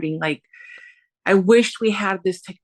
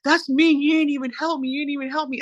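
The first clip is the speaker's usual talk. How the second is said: retold indignant complaint; 7 semitones higher than usual. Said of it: "And he's like".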